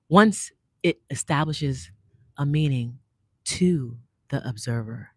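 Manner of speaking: slow, soft, poetic reading; hushed spoken-word reading, phrase by phrase. Shown as a demonstration. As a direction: read it slowly like a poem, pausing between phrases. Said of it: "Let's slow it down".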